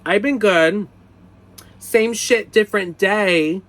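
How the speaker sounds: high, loud, mock-awkward voice; awkward nervous reply. Mocking his own nervous small talk with the clerk, high and loud. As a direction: play your own flustered answer to a crush. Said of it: "So I would say stupid things like".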